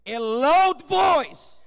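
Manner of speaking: loud, drawn-out preacher's proclamation; big slow emphatic cry. Acted. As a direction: belt it out slowly, stretching each word. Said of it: "sumisigaw siya".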